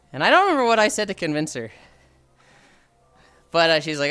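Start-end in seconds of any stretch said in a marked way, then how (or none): none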